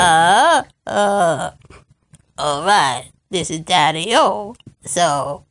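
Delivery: forced very deep voice; Daddy-O impression. Bella tries to sound deep like Daddy-O, put-on low voice. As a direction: drop way down, badly faking a man's low voice. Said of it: "make my voice go deep like yours".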